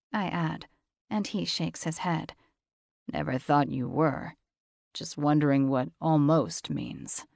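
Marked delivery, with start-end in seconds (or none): 3.1-7.3 lower, husky-free steady man's voice; low male voice. A narrator's reading. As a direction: voice him low and cool.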